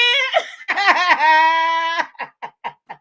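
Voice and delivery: big loud burst of real laughter. Real, not acted. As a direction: let out a loud cackle. Cracking up at the lawsuit.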